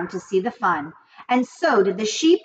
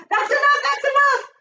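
cried out. A narrator's reading. Rat cuts off the sheep's food list, high excited cry. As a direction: cut him off, unable to bear more. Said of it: "cried Templeton".

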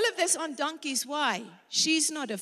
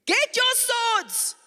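David's order; 10 semitones higher, slower than usual. Acted. Preacher acts out David's order.

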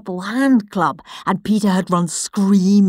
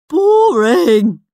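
yawning boredom; 6 semitones higher, 8 dB louder than usual. Acted. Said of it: "said Henry, yawning".

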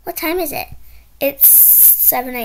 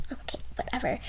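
whisper; 11 dB quieter, faster than usual. Acted. Sulky teen mutters.

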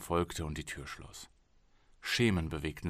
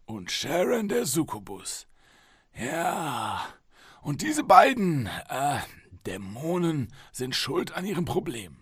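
indulgent higher doctor voice; 8 semitones higher, 5 dB louder, slower than usual. Acted. Psychologist humouring patient.

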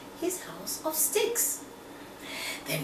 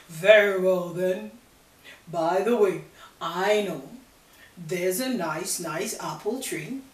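a hint of sly wolf; 2 semitones lower, 6 dB louder than usual. Wolf answers.

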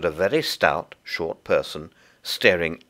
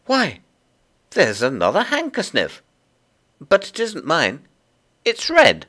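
put-on high child's voice; exclaim, then object in puzzlement; puzzled little girl; 10 semitones higher, 4 dB louder than usual. Lucy finds another handkerchief not hers, high and puzzled.

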